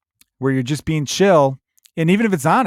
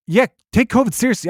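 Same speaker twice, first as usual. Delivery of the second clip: ordinary talk, nothing marked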